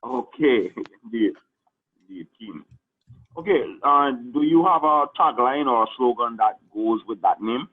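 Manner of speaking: normal talking voice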